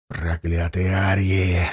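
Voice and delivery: low, slow, hissed through the teeth; chained singer hissing hatred at his captors; hissed curse. Acted. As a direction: spit the curse out low and venomous. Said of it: "зашипел гусляр".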